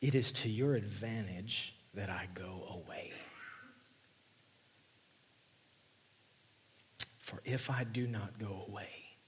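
soft hushed reading of the promise; preacher whispering Jesus' promise; whispered scripture. Acted. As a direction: breathe the verse like a secret.

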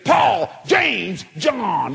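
high, loud shouts of amazement; excited proclaiming. Retold or imitated. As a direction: holler each name like a thrilling discovery. Re-enacts hollering out the names.

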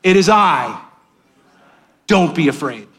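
call-and-response with the congregation; preacher leading the room through the words. Shown as a demonstration. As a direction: lead them to say it with you. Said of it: "Say it with me".

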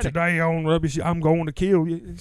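villain threat in a wrestling promo, hammed up; mock villain threat. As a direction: ham up a heel's death-threat promo.

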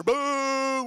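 loud drawn-out boo; crowd booing. Retold or imitated. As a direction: boo like a draft-day crowd. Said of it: "he's like".

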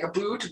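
"a boot" said softly, like the Canadian "about". Shown as a demonstration. Canadian speaker demonstrating her "about" for an interviewer. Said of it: "they say, I say".

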